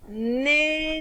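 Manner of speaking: "Nénuphar" said slowly and deliberately; teacher repeating a dictation word; repeated dictation. Shown as a demonstration. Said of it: "Je répète".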